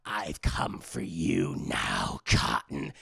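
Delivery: very low, slow creepy whisper; slow menacing slasher whisper; Ghostface whisper. Retold or imitated. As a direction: breathe it like a stalking killer. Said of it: "And Ghostface, he's like".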